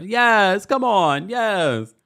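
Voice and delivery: loud, high mock encouragement; imitated cheering. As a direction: cheer along as if clapping. Mimics Stacey cheering and clapping.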